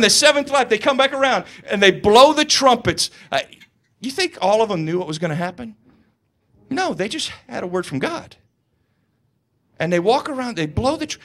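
normal talking voice

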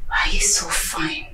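whispered tipsy come-on; tipsy flirting. Acted. Tipsy woman flirting with man.

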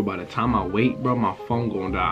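ordinary talk, nothing marked

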